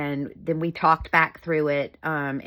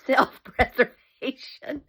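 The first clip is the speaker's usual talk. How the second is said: slow, high words squeezed through laughter; real laugh swallowing the word; 6 semitones higher, slower than usual. Real, not acted. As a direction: wheeze it out while laughing. Laughing at her punchline.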